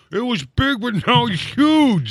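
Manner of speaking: high, slow goofy Todd voice; doing a dopey Todd impression. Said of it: "put his truck in ... and said".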